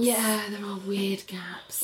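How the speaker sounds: whispered. Real, not acted. Echoing sister in a whisper.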